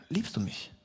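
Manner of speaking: Jesus asking a second time, very soft; soft question. Retold or imitated. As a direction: ask it almost silently. Jesus asks again.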